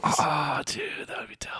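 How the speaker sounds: soft breathy whisper, barely voiced. Real, not acted. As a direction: whisper it as an aside. Aside after the reboot idea.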